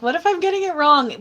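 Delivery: high-pitched nervous self-quote. Retold or imitated. Medium doubting the reading, nervous. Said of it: "I get nervous 'cause I'm like".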